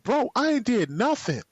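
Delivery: high, insistent denial; indignant protest. Retold or imitated. Defending himself to Rashard, high indignant protest. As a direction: plead innocence, wound up.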